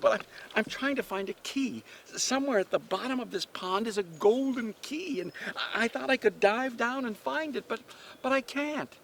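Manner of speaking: put-on high-pitched, plaintive girl; distressed girl. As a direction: stammer it out high and upset, near tears. Distressed girl explains her trouble.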